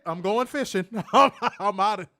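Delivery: loud, laughing through the words. Retold or imitated. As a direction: laugh while bailing out. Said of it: "I'd be like".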